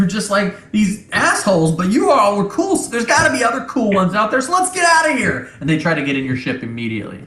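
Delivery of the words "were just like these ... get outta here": high, pleading goblin chatter; a squeaky goblin begging to leave. Acted. Goblin begging the crew to take them along, high voice.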